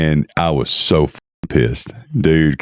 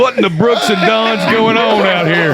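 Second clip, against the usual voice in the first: hammed-up cowboy. Joking about the cowboys in a squeaky cartoon voice. Mocking the cowboy parking confrontation.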